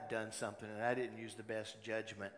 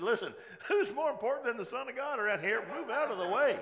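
higher, louder pompous demand with a laugh; mock arrogance; 11 semitones higher, 9 dB louder than usual. Pastor mocking a proud Jesus, laughing arrogant demand. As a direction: ham up a self-important bully.

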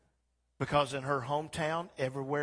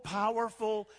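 ordinary talk, nothing marked

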